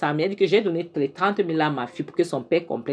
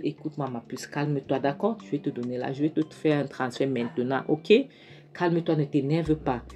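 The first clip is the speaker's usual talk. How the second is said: much softer, calming voice; soothing; 5 dB quieter than usual. Retold or imitated. Daughter soothing her upset mother over the phone, softly. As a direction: settle her down gently.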